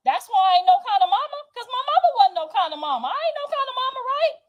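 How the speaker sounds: high, loud, rapid indignant rant; real angry outburst. Real, not acted. Daughter confronting her mother over motherhood, high-pitched indignant rant. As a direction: throw it back at her, pitch climbing fast.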